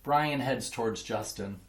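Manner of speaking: plain reading voice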